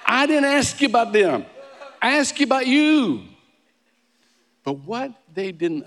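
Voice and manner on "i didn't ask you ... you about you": loud, high exasperation, hammering each phrase; raised-voice mock frustration; frustrated interviewer. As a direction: act out an interviewer at the end of their patience. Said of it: "how frustrated an interviewer is".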